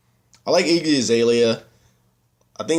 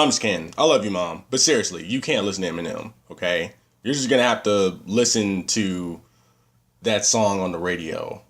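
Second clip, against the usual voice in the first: ordinary talk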